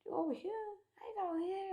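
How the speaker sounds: lost and disoriented; confused surprise. Retold or imitated. Waking up confused after choking.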